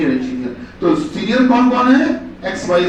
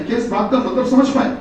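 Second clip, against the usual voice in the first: ordinary talk, nothing marked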